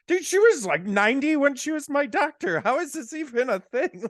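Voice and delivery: high, disbelieving mock exclamation; a high, exaggerated "how is this real" voice; incredulous. Incredulous quote about old doctor.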